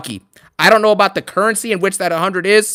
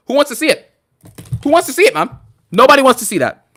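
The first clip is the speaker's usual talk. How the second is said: mock call-out; 5 semitones higher, faster than usual. Mock calling out to chat.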